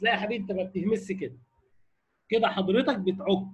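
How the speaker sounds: normal talking voice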